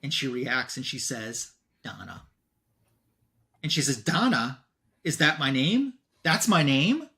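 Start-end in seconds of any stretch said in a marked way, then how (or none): 1.8-2.2 much softer, lower single word; quiet recognition. Retold or imitated. Elmira recognizing the doll from the orphanage, quiet recognition. 4.0-7.1 louder retold disbelief, rising at the end; astonished questioning. Donna learning her own name from Elmira, astonished questioning.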